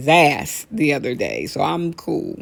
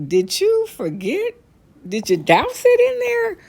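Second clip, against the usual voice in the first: rising higher with each mock question; incredulous; 4 dB louder than usual. Comic mocking a bad barbecue cook, incredulous climb. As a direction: needle the cook with climbing disbelief.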